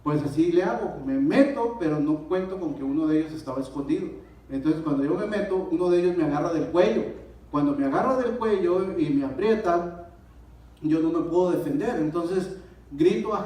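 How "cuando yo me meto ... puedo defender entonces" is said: ordinary talk, nothing marked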